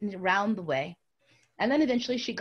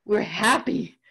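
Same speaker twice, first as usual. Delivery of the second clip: usual speaking voice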